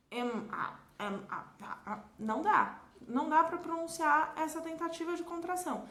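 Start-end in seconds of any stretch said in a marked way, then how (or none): 0.1-2.0 trying to squeeze "am I" together and trailing off to "a"; failed contraction. Shown as a demonstration. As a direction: fumble the contraction "am I" into "a", "a". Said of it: "eu não consigo dizer".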